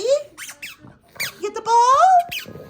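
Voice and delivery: very high, very slow drawn-out coo; pet talk. Real, not acted. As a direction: stretch it out sweetly to the dog. Drawn out to the dog.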